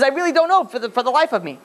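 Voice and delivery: high-pitched, acted pleading; scared protest. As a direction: plead ignorance like a frightened man. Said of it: "So the guy gets very scared".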